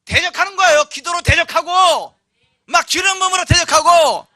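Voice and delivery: high, loud rallying shouts; rally them to resist with all your voice; full-force preaching. Real, not acted. Preacher urging prayer against every hardship, shouted.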